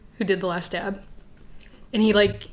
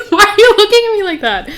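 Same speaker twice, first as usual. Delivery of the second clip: laughing outburst; 12 semitones higher, 12 dB louder than usual. Real, not acted.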